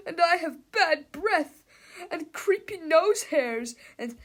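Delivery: put-on high, loud, slow complaining kid voice; whining kid. A narrator's reading. Krupp-in-Melvin voice lamenting.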